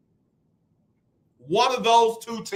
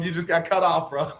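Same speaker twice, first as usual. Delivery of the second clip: usual speaking voice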